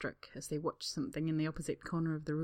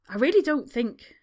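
high, loud protest; 7 semitones higher, 11 dB louder than usual. Acted. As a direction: try to object loudly. Staff member protesting.

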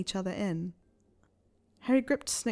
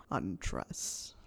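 very low, hushed order; whispered command; 13 semitones lower, 8 dB quieter than usual. A narrator's reading. Snape ordering Harry to undress, low whisper.

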